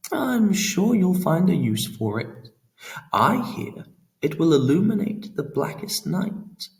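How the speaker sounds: lightly voiced sly god, amused; Pan. A narrator's reading. Pan teasing Laura about the flare, airy and sly.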